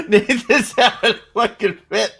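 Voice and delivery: loud, high laughter through the words; real laughing fit. Real, not acted. Viewer cracking up at the scene, laughing fit. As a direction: talk through helpless cackling.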